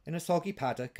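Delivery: usual speaking voice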